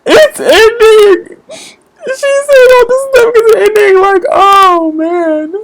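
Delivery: high, loud, hammed-up sobbing wail; mock crying. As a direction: wail like a fan whose favourite series just ended. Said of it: "And I was like".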